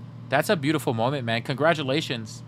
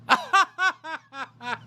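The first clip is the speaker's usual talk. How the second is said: very high posh titter; hammed-up snooty giggle; a pretend millionaire's laugh; fake rich laugh; 13 semitones higher than usual. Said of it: "I'm working on my rich laugh".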